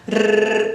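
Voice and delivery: rrr as a long rolled trill, sound only; the isolated sound rrr, not the letter name. Shown as a demonstration. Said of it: "você diz o som".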